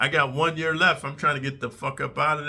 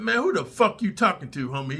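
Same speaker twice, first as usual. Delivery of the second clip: angry comeback; 6 semitones higher than usual. Retold or imitated. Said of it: "I said".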